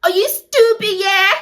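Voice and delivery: high, loud, hammed-up scolding; mock angry sister. Sister refusing to let him speak to her, mock angry scolding. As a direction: tell him off in exaggerated outrage. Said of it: "she was also just mad".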